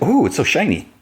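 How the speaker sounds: high, lightly cooed mock delight; acted admiration. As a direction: play someone dazzled by a trophy. Lois admires award once alone.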